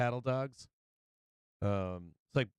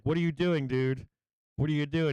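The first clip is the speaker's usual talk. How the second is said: mock scolding; 4 semitones higher, 4 dB louder than usual. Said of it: "And I'd be like".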